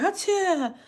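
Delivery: high, loud acted sneeze "Hachoo"; mock sneeze. Retelling a runny nose at the nail shop, hammed-up sneeze. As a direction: burst out a big fake sneeze.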